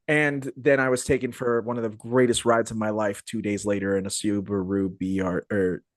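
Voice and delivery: normal talking voice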